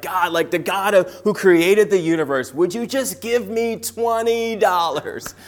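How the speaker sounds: a wide-eyed, all-in beg to God; reenacting a desperate college kid's prayer for cash; pleading prayer.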